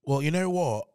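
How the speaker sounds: low, measured British accent. Shown as a demonstration. Comedian showing off his best accent to the hosts, British accent. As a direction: put on an English accent from London. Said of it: "C'est quoi ton meilleur accent?".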